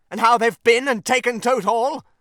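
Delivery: high, loud breaking of bad news; outraged exclamation. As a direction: cry out the news, scandalised. Rat breaks the shocking news.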